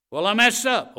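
high, loud mock complaint; hammed-up outburst of a struggling believer; believer's loud excuse. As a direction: wail the excuse out at full voice.

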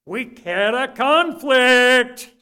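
slow, loud, each word hit; slow emphatic punch. Real, not acted. Preacher telling the church of his marriage, slow emphatic punch. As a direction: land it hard and slow.

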